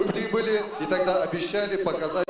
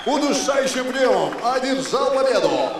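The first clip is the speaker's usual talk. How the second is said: ringmaster; 3 semitones higher, 5 dB louder than usual. Acted. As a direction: stretch the winner's name for the crowd. Ring announcer introduces winner.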